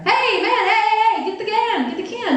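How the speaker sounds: high, urgent calling out; acted alarm. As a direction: call a warning to the zookeeper. Acted calling out a warning to zookeeper.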